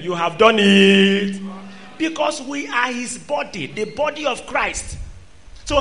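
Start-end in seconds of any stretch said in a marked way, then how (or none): 0.0-1.4 emphatic. Acted. Preacher drives home scripture.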